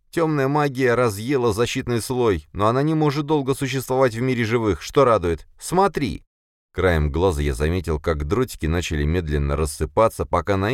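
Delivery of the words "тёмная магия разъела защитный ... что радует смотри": higher, anxious sidekick voice; urgent, jittery friend pleading; nervous young man. Acted. (нервный молодой парень)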